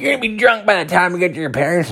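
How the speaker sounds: high, fast nagging voice; mock nagging in falsetto; imitating scolding wife. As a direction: rattle it off high like a fed-up wife. Wife scolds him, retold.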